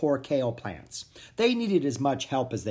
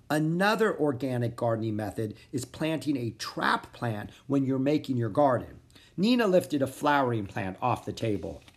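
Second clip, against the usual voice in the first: usual speaking voice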